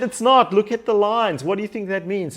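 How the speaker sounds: high, loud insistent correction; mock exasperation. Son correcting Mum about the test, high exasperated insistence. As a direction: insist loudly, pitched high.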